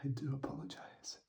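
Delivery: soft whispered apology; whispering. Real, not acted. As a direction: whisper it gently.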